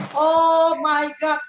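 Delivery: loud, drawn-out exclamation; re-enacted dismayed yell; retold shout. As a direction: cry out in alarm, slow and high. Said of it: "saya teriak".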